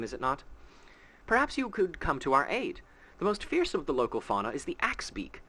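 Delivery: a hint of a gentle temple keeper; lightly voiced priestess. Reads NPC welcome speech aloud.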